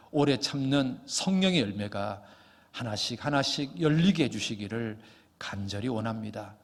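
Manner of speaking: low-pitched, earnest congregational prayer; closing prayer. Real, not acted. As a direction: pray aloud on behalf of the congregation, pitched low.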